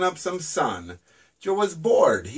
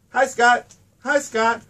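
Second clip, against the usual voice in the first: crow calling; 5 semitones higher, 4 dB louder than usual. A narrator's reading. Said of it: "was all Joe would say".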